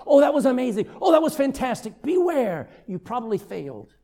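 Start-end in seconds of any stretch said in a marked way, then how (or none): 0.1-2.0 imitated over-the-top compliment; gushing praise. Congregation praises someone's public prayer, mock gushing. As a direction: gush like an admiring crowd, higher and louder. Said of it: "everybody goes".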